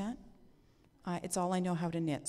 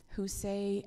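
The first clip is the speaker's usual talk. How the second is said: ordinary talk, nothing marked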